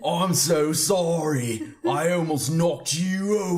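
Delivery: bad Scottish accent. Showing friends the actor's apology, botched Scottish accent. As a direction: attempt Scottish, land on Australian. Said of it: "that's Australian".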